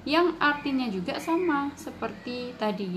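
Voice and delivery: normal talking voice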